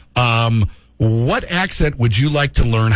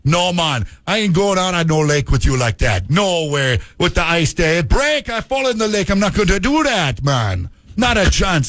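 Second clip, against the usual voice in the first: animated imitation of a Jamaican refusing; mock-indignant Jamaican lilt; Jamaican accent; 3 semitones higher than usual. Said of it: "a guy from Jamaica".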